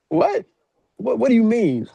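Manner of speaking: very high-pitched, loud mock shock; yelped disbelief, high and punchy; incredulous. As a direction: imitate a dad who can't believe it. Retells dad's reaction to confession.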